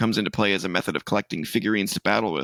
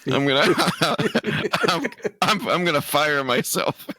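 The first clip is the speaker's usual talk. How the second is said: high words broken by real laughter; laughing; 6 semitones higher than usual. Real, not acted. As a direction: struggle to get it out while laughing. Breaking up laughing at own joke.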